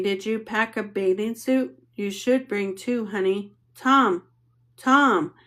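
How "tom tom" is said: louder, higher call for a son's attention; mother summoning her son; calling a child. A narrator's reading. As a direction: call out the boy's name sharply.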